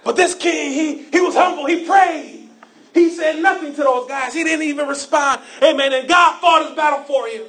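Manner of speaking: rising preacher's cadence with shouted lines; preaching. Acted. Pastor to congregation, preaching with rising force.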